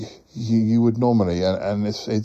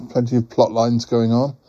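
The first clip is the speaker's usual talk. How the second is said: usual speaking voice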